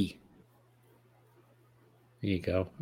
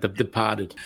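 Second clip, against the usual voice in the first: mock Boston accent, "Departed" as "Depahted"; hammy Boston voice saying "The Departed"; bad Boston accent; 3 semitones lower, 4 dB quieter, slower than usual. Shown as a demonstration. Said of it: "You gotta go bad Boston accent".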